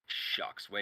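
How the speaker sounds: whispered "Shucks"; affable shucks. Acted. Boss embarrassed about the money, whispered "Shucks". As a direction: breathe "Shucks" sheepishly. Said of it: "in a very affable".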